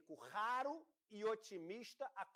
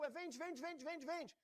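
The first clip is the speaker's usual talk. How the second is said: high, softer rapid chant of traders; mock panic; 8 semitones higher, 4 dB quieter, slower than usual. Everyone starts selling in despair, mock panic. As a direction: repeat it urgently, pitched right up. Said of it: "todo mundo entrou em desespero".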